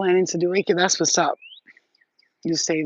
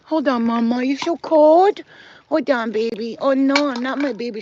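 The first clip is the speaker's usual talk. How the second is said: high, loud fussing over the puppy; 6 semitones higher, 10 dB louder than usual. Real, not acted. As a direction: coo loudly at the shivering dog. Talking to shivering dog.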